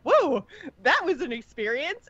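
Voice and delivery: imitating Illyria. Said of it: "and she's like".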